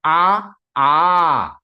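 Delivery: A built up from long to extra-long "aaah"; extended plutaha vowel. Shown as a demonstration. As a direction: count out the A, stretching the last into "aaah". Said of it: "It is extended three times".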